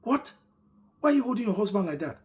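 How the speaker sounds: high, loud scolding shout. Retold or imitated. Mama shouting at the wife holding her husband. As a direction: yell it at her in disbelief. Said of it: "Mama shouted in her presence".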